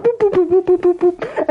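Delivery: rapid string of boops; button beeps. Retold or imitated. Shadow works the control room buttons, imitated boops.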